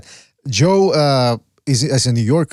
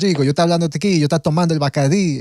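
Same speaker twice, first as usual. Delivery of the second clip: fast Dominican Spanish; rapid Dominican accent; 3 semitones higher, faster than usual. Retold or imitated. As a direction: rattle it off like a Dominican. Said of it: "five hundred miles an hour".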